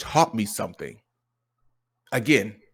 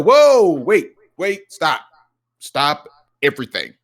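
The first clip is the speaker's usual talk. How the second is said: loud, higher "whoa" to cut in; halting interruption; 5 semitones higher, 10 dB louder, slower than usual. Retold or imitated. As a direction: burst in to stop a rambling child. Cutting off his son's rambling.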